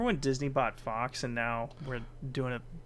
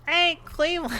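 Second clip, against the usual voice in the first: very high-pitched cartoon wife voice; high, exaggerated cartoon-mom impression; Lois impression; 4 dB louder than usual. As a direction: call out high, in a put-on voice. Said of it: "I'll do my lowest impression".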